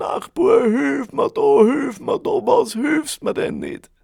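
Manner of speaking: very high, very loud anguished cry; desperate wailing shout. A narrator's reading. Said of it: "das Klagen ist immer lauter geworden". (verzweifelter klagender Schrei)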